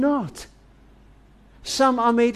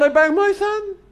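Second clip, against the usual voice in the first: put-on much higher child's voice reporting a banged thumb; child's voice, pitched well up; hurt little boy; 12 semitones higher than usual. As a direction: sound like a small boy who got hurt. A hurt boy tells the operator.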